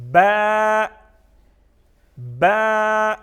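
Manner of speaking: با held long and loud on a level note; drawn-out letter. Shown as a demonstration. Teacher drilling the next letter, با loudly stretched. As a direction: chant با with a stretched vowel. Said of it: "اس کو تھوڑا سا کھینچ کے پڑھنا ہے".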